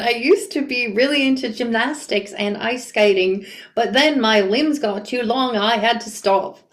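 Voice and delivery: put-on wobbly amateur Australian accent; badly faked Australian accent; pathetic Australian accent. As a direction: attempt Australian and not quite get there. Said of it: "I can't do the accent".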